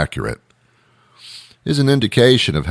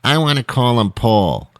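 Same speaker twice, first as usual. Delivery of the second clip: parent insisting on a name, a bit higher; stubborn parent pushing for Paul; arguing parent; 6 semitones higher than usual. Retold or imitated. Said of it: "couldn't make a decision".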